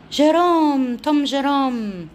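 high, loud call, slow and drawn out on the second name; calling the cat. Real, not acted. Owner calling her shy cat, high and loud. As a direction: sing out the cat's name across the room.

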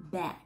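"back" with a tense a and crisp k; "back" as /bæk/, k released; back demo. Shown as a demonstration. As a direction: land the k on "back". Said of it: "mình đọc đúng thơ âm này".

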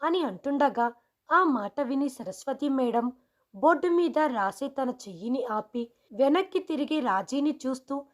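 ordinary delivery

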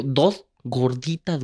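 each word drawn out for a foreigner; slow ordering. Retold or imitated. Tourist orders slowly from the Egyptian vendor. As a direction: spell it out slowly so he understands.

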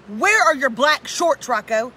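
slow, loud parent demand; stern parent. Retold or imitated. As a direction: demand it slowly like a strict mom. Parent questioning son, retold.

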